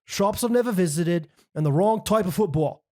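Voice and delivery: hammed-up English accent from London; cheeky Londoner voice, read with gusto. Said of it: "I'm gonna say it in his accent".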